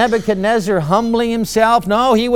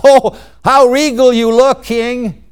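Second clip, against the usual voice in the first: higher, louder gushing flattery; with conviction; 3 semitones higher, 3 dB louder than usual. Acted. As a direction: gush over the king as a hammed-up courtier. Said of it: "That's the way I want to hear it".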